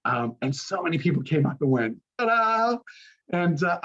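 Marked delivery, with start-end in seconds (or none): 2.2-2.8 bright, much higher celebratory ta-da; joyful ta-da. Retold or imitated. Congregants greet him leaving, shouting a joyful ta-da. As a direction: cheer it out like an audience greeting.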